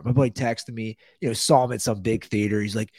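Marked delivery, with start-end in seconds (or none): none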